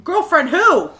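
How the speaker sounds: loud, high mock-astonished question; startled disbelief. Playing Ricky hearing about a girlfriend, loudly incredulous. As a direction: imitate someone blurting out in shock.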